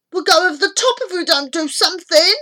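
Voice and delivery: high, fast, loud alarm; hurried frightened yell; shout. A narrator's reading. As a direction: shout it in a rush of panic. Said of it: "shouted Kieran".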